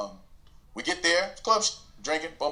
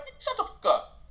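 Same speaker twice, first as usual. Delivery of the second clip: high, sharp snapped insult; shutting down the friend, sharp and high; snapping at him; 14 semitones higher than usual. Retold or imitated. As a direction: bite it off at him. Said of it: "I'm like".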